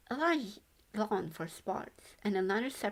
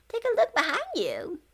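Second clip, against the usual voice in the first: a very high, louder ghost voice; 10 semitones higher, 6 dB louder than usual. A narrator's reading. Ghost showing Yuya the view, high and bright. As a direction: point it out eagerly.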